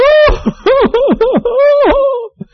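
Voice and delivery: hammed-up sob. Drinker in a bar breaking into a mock wail. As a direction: wail like a man at the end of his rope. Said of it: "the guy starts sobbing".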